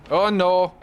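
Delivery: sudden high, loud oh no; the jolt of hitting someone; alarmed cry. Real, not acted. Driver yelps as he turns into a car, alarmed.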